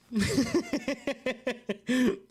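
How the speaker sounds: high, rapid staccato cackle; laughing. Real, not acted. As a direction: crack up in a long run of high giggles. Cracks up at own cringe bit.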